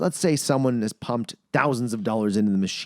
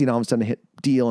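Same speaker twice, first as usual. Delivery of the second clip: ordinary delivery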